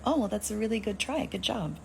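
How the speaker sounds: high, soft condescending praise; mock encouragement. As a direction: imitate patting someone on the head. Retelling her condescending reply to him.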